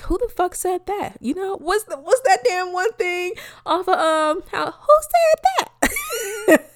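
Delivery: high, incredulous therapist voice, pitched well up; imitating her therapist. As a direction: play the therapist, baffled and high. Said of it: "my fucking therapist be looking at me like".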